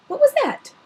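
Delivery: breaks off, then jumps way up in pitch; sudden alarm at a noise; startled. Acted. Sudden noise interrupts McGonagall.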